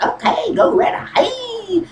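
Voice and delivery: laughing. Acted. Wife mocking husband's plan.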